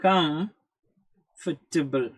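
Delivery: "Comfortable" stretched out very slowly as "kumf-ta-bl"; slow-motion squeezed "comfortable". Shown as a demonstration. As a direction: drag each syllable out for the learner. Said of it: "exact pronunciation".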